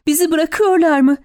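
high, alarmed question; horrified cry. A narrator's reading. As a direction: cry it in terror, volume held back. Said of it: "diye çığlık attı dehşetle".

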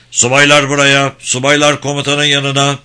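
loud, high summons across the camp; called-out order. A narrator's reading. Officers summoned to the commander, called out loudly. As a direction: call out like a herald to the officers.

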